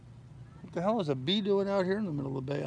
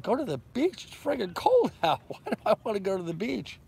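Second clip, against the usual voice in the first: incredulous; 7 semitones higher than usual. Retold or imitated. He balks at cold beach.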